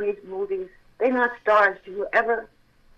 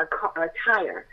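normal talking voice

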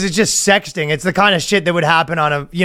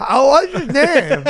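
high, slow, coy questioning; pry with drawn-out mock innocence; over-interested confessor; 10 semitones higher, slower than usual. Acts over-interested confessor probing.

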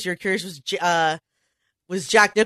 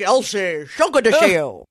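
Brokaw greeting impression. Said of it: "Tom Brokaw is the same way".

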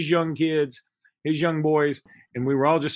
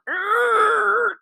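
high, loud creak of an opening door; mock creaky-door sound effect; imitated creaking door; 5 dB louder than usual. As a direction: squeak it like a haunted door hinge. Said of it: "he made the little noise".

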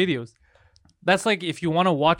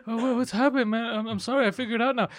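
high-pitched flustered apology; imitated freaking out; 6 semitones higher than usual. Host mimicking his friend caught in the hallway, high flustered panic. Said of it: "He's freaking out".

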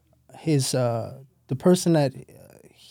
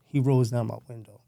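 ordinary talk, nothing marked

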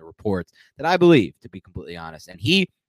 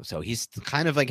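ordinary talk

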